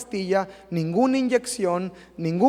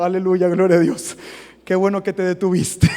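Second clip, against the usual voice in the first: loud, happy hallelujah to a church member; joyful praise; 7 dB louder than usual. Retold or imitated. Pastor praising a calmer member, loud and joyful. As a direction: burst out praising God, loud and glad.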